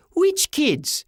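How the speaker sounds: put-on very high boyish demand; shocked kid's high-pitched yell; urgent yell. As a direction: cry it out high, alarmed. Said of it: "Sean yelled".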